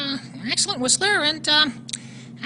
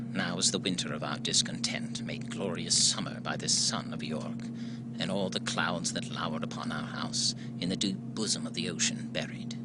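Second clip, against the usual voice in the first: dramatic reading; 15 dB quieter than usual. Acted. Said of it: "I had something from Richard the Third prepared".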